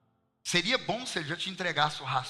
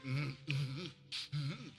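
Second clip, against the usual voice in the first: a mock tongue-tied hum, softer; imitated stammering; 7 dB quieter, much slower than usual. Pastor imitating the friend who can't answer, mock stammer. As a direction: hum and stall like a man lost for words. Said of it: "gaguejando e tentando falar".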